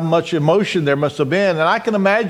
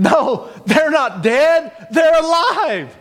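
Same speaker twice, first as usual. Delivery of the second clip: high, slow, insistent declaration; emphatic joyful assertion; 8 semitones higher, slower than usual. Acted. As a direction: contradict grief with a drawn-out, rising cry. Acted cry of hearts over grief.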